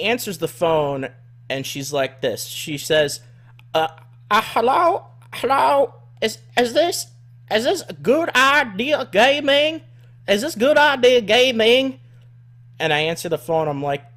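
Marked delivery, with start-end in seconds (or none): 3.7-12.0 high, loud mock Southern caller voice. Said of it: "redneck woman who sounds incredibly overweight from, like, Arkansas".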